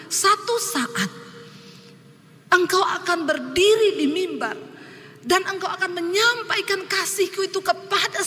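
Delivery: measured, majestic promise; voice of God. Retold or imitated. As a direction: speak as God calling him to the pulpit. God speaks in a vision.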